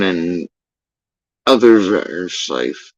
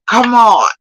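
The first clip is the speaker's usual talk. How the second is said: loud, high "come on"; exasperated outburst; 11 semitones higher, 6 dB louder than usual. Real, not acted. A viewer venting about the alert, loud and exasperated. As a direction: throw the words up in frustration.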